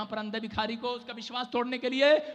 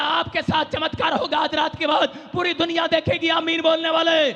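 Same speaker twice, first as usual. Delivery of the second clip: loud, higher, half-sung preaching; preaching at full force; 4 semitones higher, 6 dB louder than usual. Acted. Healing preacher stirring his congregation before prayer, shouting. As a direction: rouse the crowd with a chanting shout.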